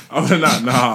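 normal talking voice

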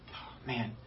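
ordinary talk

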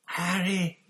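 high, loud gasp of relief. A narrator's reading. Pettigrew clings to Harry, gasping. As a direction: gasp out the name. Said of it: "gasped Pettigrew".

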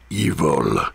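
low, drawn-out villainous word; ominous. A narrator's reading. Narrator reads the red display, low and ominous. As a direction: drop deep for a sinister label.

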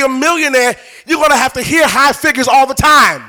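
building, insistent preacher's delivery; emphatic sermon in full flow; forceful preaching. Real, not acted.

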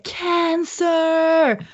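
high, long drawn-out cry of alarm; mock scream. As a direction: imitate her shrieking a warning, stretching the word. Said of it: "kommer hon springandes och bara skriker". (härmar ett utdraget, högt skrik)

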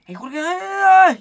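high, rising to a loud cry; hammed-up mother's shriek; alarmed mom. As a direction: wail like a startled mother. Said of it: "mi mamá sí era de".

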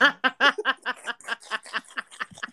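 long giggling laugh; long giggle remembering him; laughing fit. Real, not acted. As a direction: let the laugh run on.

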